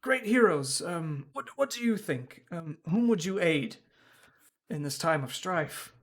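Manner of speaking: high, pleading address to heroes; lightly voiced priest. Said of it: "and they say".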